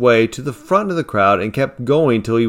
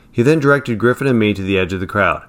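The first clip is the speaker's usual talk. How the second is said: plain reading voice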